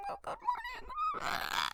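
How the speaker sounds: tiny, high, strained voice; slow, soft, high-pitched mock boy; mimicked reluctant reply. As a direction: squeeze out a slow, reluctant greeting. Imitates boy's reluctant reply.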